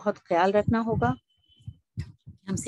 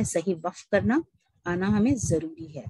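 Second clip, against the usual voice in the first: normal talking voice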